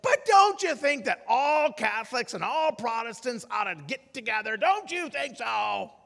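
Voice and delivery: high, insistent loud questioning; loud seatmate voice. Retold or imitated. Loud passenger pushing his seatmate to agree, high and insistent. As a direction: press the question for everyone to hear. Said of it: "with that same loud voice".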